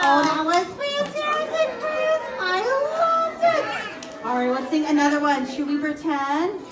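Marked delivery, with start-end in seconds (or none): 0.0-4.2 put-on high, delighted praise for kids; excited praise. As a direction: cheer the children on. Praising children after song.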